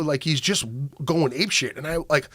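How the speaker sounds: ordinary talk, nothing marked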